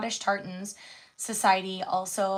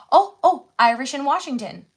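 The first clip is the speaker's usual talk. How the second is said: loud, high, quick discovery; excited find, much louder; 5 semitones higher, 12 dB louder, faster than usual. Real, not acted. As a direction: burst out as you find it. Finds search result excitedly.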